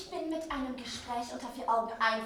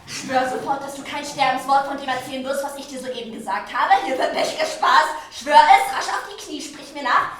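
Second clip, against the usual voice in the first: louder, urgent orders, edged with laughter; loud commanding demand; 11 dB louder than usual. Acted. Queen orders her lady to kneel and swear, in a loud urgent voice. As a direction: make her swear, no arguing.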